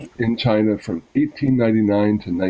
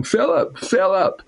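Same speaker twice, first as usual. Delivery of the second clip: high-pitched cry of a name; shouted call; 10 semitones higher, slower than usual. A narrator's reading. Horrified mother rushing out to her son on the lawn, shouting. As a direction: call out in horror, pitch leaping up.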